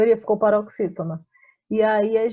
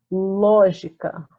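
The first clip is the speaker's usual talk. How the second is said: "lógica" slow and louder, leaning on the ló; slow, louder "lógica" with ló stressed; syllable stress demo; 6 dB louder, much slower than usual. Shown as a demonstration. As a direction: stretch out "lógica" to show the stressed ló. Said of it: "tá aqui no nosso ló".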